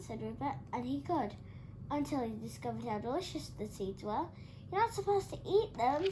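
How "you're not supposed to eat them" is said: high scolding shout. A narrator's reading. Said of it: "shouted Dora".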